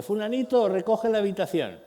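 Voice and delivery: a fed-up parent hollering for the first time; mock parent yell. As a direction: shout at level three, still holding back. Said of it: "Nivel grito tres".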